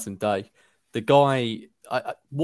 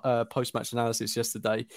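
ordinary delivery

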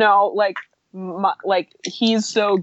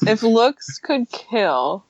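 slow dramatic; 4 semitones higher, much slower than usual. Pretend. Repeating the joke dramatically.

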